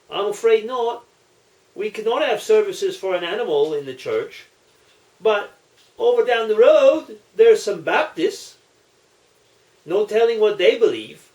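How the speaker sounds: a touch of an Irish priest explaining; lightly voiced parish priest. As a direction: let the man down gently, as the priest.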